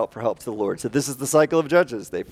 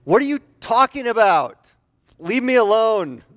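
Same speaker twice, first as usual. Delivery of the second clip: dismissive brush-off; 7 semitones higher than usual. Pretend. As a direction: wave the stranger away. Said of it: "Or you could be like".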